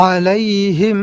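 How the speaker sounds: আলাইহিম with the হিম hummed long; held final im. Shown as a demonstration. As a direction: hold the sound at হিম. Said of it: "এইভাবে আওয়াজটাকে ধরে থাকব". (হিম-এ আওয়াজ ধরে রাখা)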